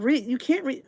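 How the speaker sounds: ordinary talk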